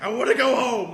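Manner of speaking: high, loud mock wail; imitated tearful scream; crying and screaming. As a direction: wail like a homesick kid, hammed up. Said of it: "like, crying and screaming".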